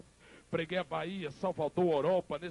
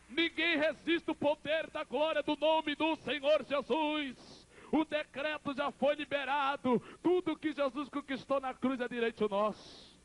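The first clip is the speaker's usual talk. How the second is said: high, ringing proclamation; full-force preaching; 9 semitones higher, slower than usual. Real, not acted. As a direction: declare it to the whole church, high-pitched.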